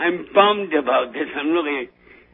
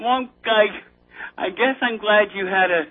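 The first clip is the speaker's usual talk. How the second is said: usual speaking voice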